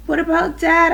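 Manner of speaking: high, loud anxious question; crying out. A narrator's reading. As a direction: cry it out, pitched well up. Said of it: "I cried".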